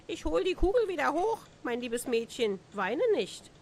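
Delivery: a high, fast frog voice rising; chirpy little creature making an offer; eager frog. A narrator's reading. Frog from the well promises the girl her ball, high and quick.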